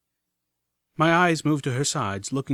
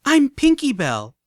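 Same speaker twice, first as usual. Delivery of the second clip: eager filly; 13 semitones higher, 8 dB louder, slower than usual. Acted. Eager filly introduces herself.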